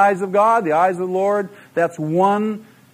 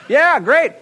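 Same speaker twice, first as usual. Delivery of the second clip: sarcastic; 10 semitones higher, 4 dB louder than usual. Acted. Sarcastic reply to objection.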